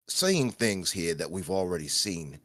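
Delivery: quiet mock Brummie accent; lightly put-on Birmingham voice, mate; a hint of a Peaky Blinders accent. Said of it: "He's not even from Peaky Blinders".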